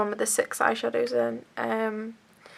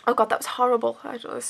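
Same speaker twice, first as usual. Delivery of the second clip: normal talking voice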